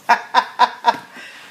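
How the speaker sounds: a real, airy chuckle under the breath; breathy laugh. Real, not acted. Cook laughing to viewers about husband watching football, airy chuckle.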